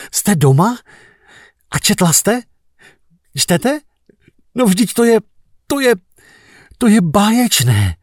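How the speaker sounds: high, laughing excited cry; laughing exclaim. Retold or imitated. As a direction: burst out gleefully on the phone. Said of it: "směje se na celé kolo, vykřikl jsem". (smějící se nadšené zvolání)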